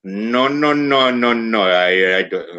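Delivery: put-on mild Indian accent, protesting no; light Indian accent, refusing again; mock Indian accent. Mocking the refusal again in a mild Indian accent. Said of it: "you get this accent".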